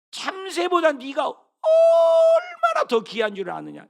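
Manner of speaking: slow, loud, high proclaiming shout; voice of God. Acted. Pastor voicing God to the congregation, slow proclaiming shout. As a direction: thunder God's words slowly. Preacher voicing God's words.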